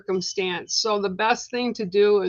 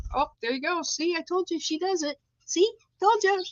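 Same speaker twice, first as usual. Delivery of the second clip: pet talk; 9 semitones higher than usual. Real, not acted. Talking to her pet bird.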